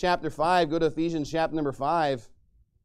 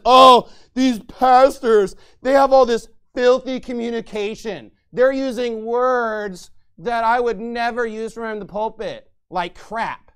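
slow, louder, mock pious pastor; holier-than-thou; 3 semitones higher, 8 dB louder, slower than usual. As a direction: sneer it like a smug preacher. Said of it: "holier-than-thou attitude the whole time and says".

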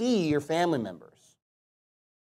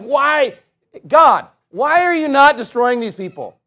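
mock frustrated complaint; 7 semitones higher, 8 dB louder, slower than usual. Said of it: "voicing frustrated Christians".

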